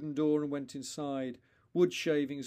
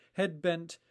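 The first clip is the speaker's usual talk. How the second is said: plain reading voice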